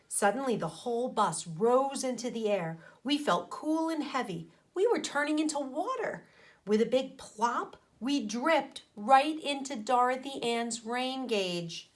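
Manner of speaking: plain reading voice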